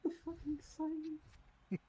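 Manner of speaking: mock anguish. Mock despair at the pause.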